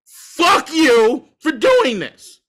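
deliberate, drawn-out curse at the owners; slow emphatic curse. Real, not acted. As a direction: spell out every word with weight. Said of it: "And can still say".

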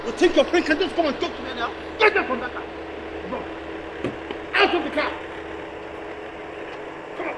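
furious yelling. Acted. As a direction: rage at her, out of control. Man yelling furiously at woman.

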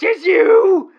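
high, loud, rattled shouting; loud flustered accusation; agitated rant. Acted. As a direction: blurt the accusation in a panic. Accusing caller of yelling.

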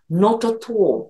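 slow British "Not at all" as "noto to", crisp t's and rounded o; British accent. Shown as a demonstration. Teacher contrasting American and British English for learners, British accent. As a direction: say "Not at all" slowly like a Brit. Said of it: "イギリス人だと".

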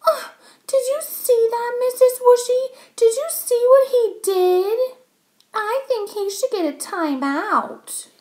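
put-on high-pitched, outraged child's whine; whining little girl. A narrator's reading. Girl tattling to teacher on the boy, high whine. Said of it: "and whined".

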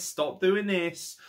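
"Stop doing this" spelled out very slowly, each word weighted; slow drawn-out repeat. Real, not acted.

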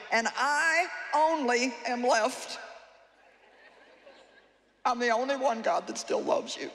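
whiny self-pity. Pretend. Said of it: "and now watch it".

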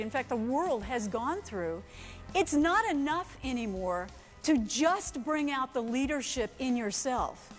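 ordinary delivery

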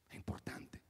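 breathy whisper of importante; whispered catchphrase. Real, not acted. The comic whispers his catchphrase to the audience. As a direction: whisper it confidentially.

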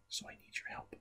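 put-on soft secret whisper to the kids. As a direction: hush it, as if not to scare him. Host whispers about shy Danny.

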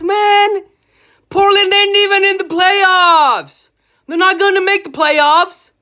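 fed-up fan blasting ESPN; angry rant. Real, not acted. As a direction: build the fury line by line. Fan rant building against ESPN.

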